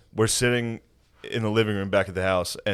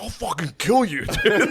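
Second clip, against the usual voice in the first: mock threat; 11 semitones higher than usual. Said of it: "he fully spazzed".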